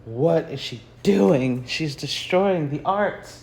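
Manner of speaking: slow, drawn-out mock horror; mock disapproval. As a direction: imitate an appalled art critic, drawing the words out. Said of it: "just shaking their head thinking".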